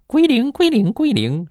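squeaky machine lady chanting zero; calculator voice. Retold or imitated. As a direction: mimic a calculator's chirpy announcement. Imitating calculator voice.

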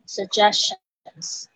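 very slow "Suggestions", syllable by syllable. Shown as a demonstration. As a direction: stretch out "Suggestions" for learners. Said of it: "语调上扬". (慢慢念"Suggestions")

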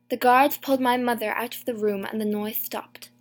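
ordinary delivery, nothing marked

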